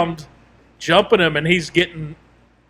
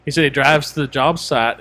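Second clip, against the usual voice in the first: ordinary talk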